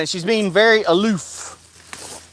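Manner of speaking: ordinary talk, nothing marked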